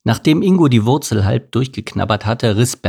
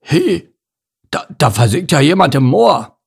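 raised, startled bear voice; high "He", then a stammered discovery; 5 semitones higher, 4 dB louder than usual. A narrator's reading. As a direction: stumble into it in sudden alarm. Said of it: "Dann stutzte er".

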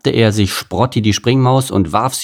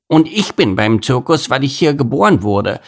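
llama; 4 semitones higher than usual. Acted. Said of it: "sagte Ludwig".

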